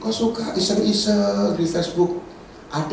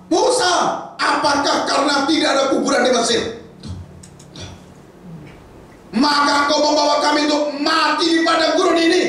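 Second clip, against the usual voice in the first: angry complaint; 11 semitones higher than usual. Acted. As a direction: hurl the accusation at Moses. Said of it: "Dan mereka berkata kepada Musa".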